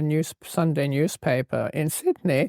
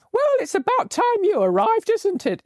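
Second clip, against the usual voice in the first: lightly hammed-up East End Cockney, higher pitched; strong Cockney accent; 13 semitones higher than usual. Traveller imitating the Cockney postmistress greeting her, in a much higher Cockney voice. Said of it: "in a strong Cockney accent".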